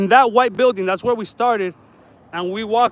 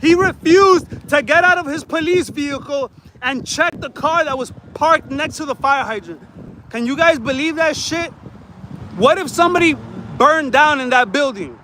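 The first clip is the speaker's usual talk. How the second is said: pitched-up indignant rant; outraged rant; 5 semitones higher than usual. Real, not acted. Complaining to viewers about a cop who stayed in his car, outraged and pitched up. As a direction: vent at the lazy cop.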